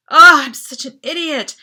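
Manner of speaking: loud, exasperated cry; frustrated outburst. A narrator's reading. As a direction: curse yourself aloud. Self-reproach, outburst.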